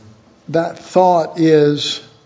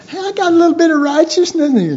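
much higher, fast, a cheeky know-it-all poking his head out; hammed-up self-righteous chirp; mocking smug voice; 10 semitones higher, much faster than usual. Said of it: "sticking your little head out and going".

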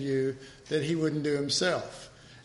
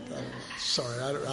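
normal talking voice

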